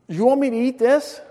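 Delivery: high, loud disbelieving question; acted-out dismay, loud and rising; incredulous question. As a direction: balk at it, pitch way up. Balking at the dish served.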